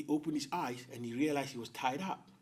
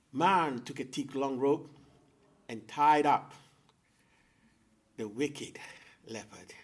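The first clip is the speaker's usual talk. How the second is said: plain reading voice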